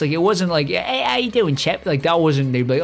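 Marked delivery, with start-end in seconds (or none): 0.7-1.9 higher-pitched mock British greeting; mock British accent. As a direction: put on a light British accent. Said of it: "like proper Brits".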